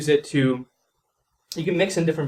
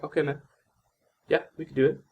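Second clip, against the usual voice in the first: nonchalant. Shown as a demonstration. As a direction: toss it off like the sale barely matters. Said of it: "And it's very nonchalant".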